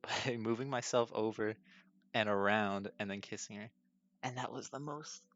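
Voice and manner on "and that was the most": hushed aside. Real, not acted. Telling friends how the kiss went, whispered. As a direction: drop to a breathy whisper, much softer and higher.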